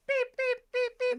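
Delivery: high, loud censor beep imitation; piercing vocal beeps, evenly repeated; imitated censor beeps. Bleeping out a friend's swearing, high imitated beeps. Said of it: "censorship beeps and bloops".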